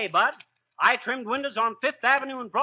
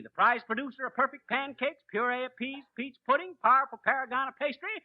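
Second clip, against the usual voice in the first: boastful alliterative patter; 4 semitones higher than usual. Acted. Braggart's alliterative title patter.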